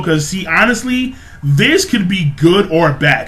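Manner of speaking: ordinary talk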